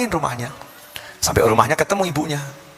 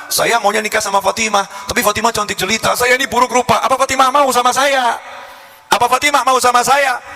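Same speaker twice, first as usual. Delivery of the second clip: higher-pitched lovelorn pupil; pleading pupil; 7 semitones higher than usual. Retold or imitated. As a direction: plead your hopeless case to the teacher. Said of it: "si murid ini ngomong ke kiainya".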